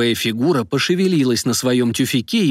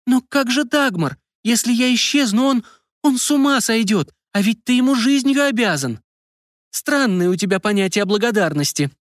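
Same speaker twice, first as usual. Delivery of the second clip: distressed; 10 semitones higher than usual. A narrator's reading. Distressed wife protests, very high.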